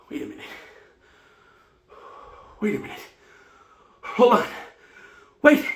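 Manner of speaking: gasped stop-start words, rising in urgency; breathless halting realization. Acted. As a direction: freeze and test each word between breaths. Acted attack suddenly shifts.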